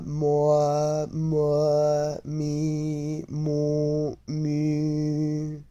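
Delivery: each syllable held high and level, from "A" to "Mi"; chant-like flat drill of vowels, then "Ma, Mo, Mi"; vowel drill in first tone. Shown as a demonstration. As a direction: sustain "A, O, E" and the rest on one even pitch. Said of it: "go through the vowels and tones".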